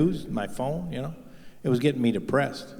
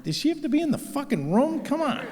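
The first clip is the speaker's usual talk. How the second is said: exasperated whine. Retold or imitated. Exasperated with wife in session.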